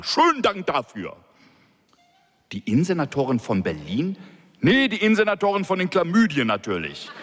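a police director fuming about the senator's call; ranting boss. A narrator's reading. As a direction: vent and sneer.

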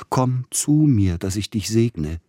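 ecstatic cry. Acted. Dying man crying out to the youth to bless him, ecstatic. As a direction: reach out and call him with rapture. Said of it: "rief der leidende Verzückte".

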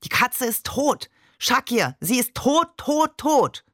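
losing patience with the caller; irritated. A narrator's reading. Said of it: "Und dann gereizt".

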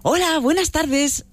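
high, slow, bright cheery hello; very upbeat greeting. Shown as a demonstration. Shopper greeting a clerk, very upbeat and cheery. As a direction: greet the shop clerk like you're thrilled to be there. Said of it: "así como muy animado".

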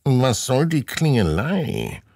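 high, drawn-out grumbling man's voice; a grumpy neighbour disturbed at his fence. A narrator's reading. Grumpy man at the fence, slow grumble. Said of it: "fragt er mürrisch".

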